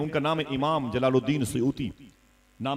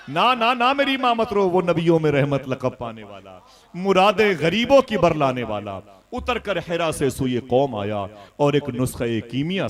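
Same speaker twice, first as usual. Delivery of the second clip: much higher, louder impassioned preaching; full-force preaching; 4 semitones higher, 7 dB louder than usual. Acted. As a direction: soar over the crowd, slowing on the big words.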